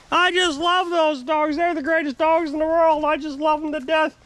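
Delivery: mock his gushing yell in a high, sustained voice. Mimicking the neighbor yelling at them about dogs, mock yelling. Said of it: "He's yelling at us".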